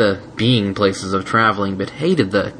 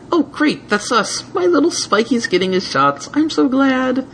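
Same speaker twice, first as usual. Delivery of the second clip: gushing. Acted. Twilight gushing over dragon's shots.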